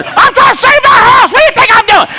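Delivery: high, indignant holler; angry shouting back. Retold or imitated. Husband answering wife about saving the house, angry shouting. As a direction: imitate a frantic husband defending himself.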